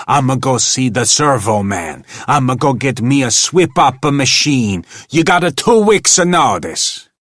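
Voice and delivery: loud, rising furious rant; angry shouting. A narrator's reading. Manager ranting at the janitor, shouting. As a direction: hurl the threats up at the booth.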